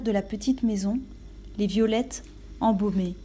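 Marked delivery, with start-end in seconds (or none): none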